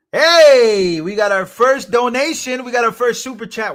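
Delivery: loud, high cheer of delight; excited celebration. Real, not acted. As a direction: burst out with a long, thrilled hey. Celebrating first donation.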